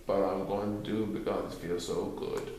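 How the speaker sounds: low, soft, mournful Eeyore; a smile creeping into a low drone; gloomy donkey voice. A narrator's reading. Eeyore admitting the praise feels good, low and soft.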